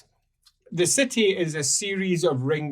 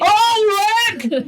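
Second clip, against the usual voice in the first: very high, loud desperate shout, slow; a guard calling on his god as the wall falls; panicked cry; 9 dB louder than usual. Acted. Another calls his god.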